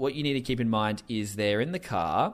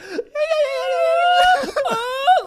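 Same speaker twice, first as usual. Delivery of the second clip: loud, very high fake sob; high-pitched mock wail; imitated dry crying; 9 dB louder than usual. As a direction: imitate forced, tearless crying. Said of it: "dry crying, this kinda, like".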